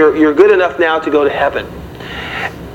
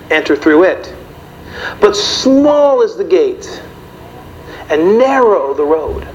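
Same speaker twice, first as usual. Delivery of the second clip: scripture reading; 3 semitones higher, slower than usual. Acted. Reads scripture slowly.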